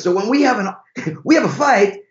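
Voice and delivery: high mock Italian, broken by laughter; awful Italian accent. Driver describes marital fights to visitors, awful Italian accent. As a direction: imitate an Italian driver while cracking up. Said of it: "That's an awful Italian accent".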